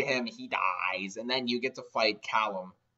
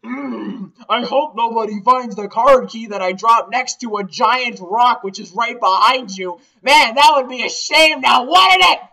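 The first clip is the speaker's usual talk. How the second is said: higher, louder, sneaky goon voice rising to the end; sly villain hint; 10 semitones higher, 13 dB louder, slower than usual. Retold or imitated. Team Flare member hinting to the player, sly and loud. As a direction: drop the hint like a smug henchman.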